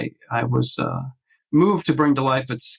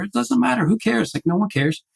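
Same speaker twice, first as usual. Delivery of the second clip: higher, fed-up and sulky; pissy self-talk, pitched up high; exasperated; 9 semitones higher than usual. Retold or imitated. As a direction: throw your hands up over it. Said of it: "I was kinda pissy about it".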